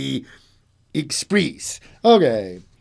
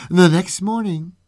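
plain reading voice

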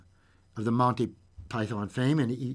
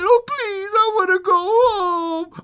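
put-on high, loud childlike wail; hammed-up tearful plea; crying little girl; 10 dB louder, slower than usual. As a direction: wail it slowly like a homesick child. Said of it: "started to cry and said".

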